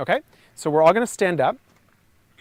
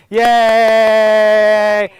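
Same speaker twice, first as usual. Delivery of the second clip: cheer; 5 semitones higher, 11 dB louder than usual. Real, not acted. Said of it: "cheering the lip trill".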